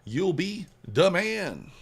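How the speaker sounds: a hammed-up hype shout for the chat; big hype call. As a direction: stretch it out like a hype man. Repeats hype line bigger.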